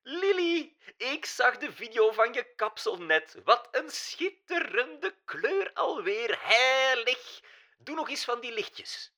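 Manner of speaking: put-on very high, slow cooing in a German accent; German-accented diva cooing over the girl. A narrator's reading. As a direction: coo it like a gushing German diva. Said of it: "kirde Mila Mossa".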